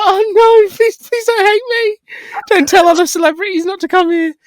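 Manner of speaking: high, pleading, hammed-up fright; high mock-terrified plea to a famous client; begging in panic. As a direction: beg the star not to hate you. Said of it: "I was like".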